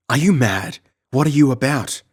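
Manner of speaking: high, loud outraged disbelief; outraged bewilderment. Acted. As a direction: demand an answer, appalled. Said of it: "in outraged bewilderment".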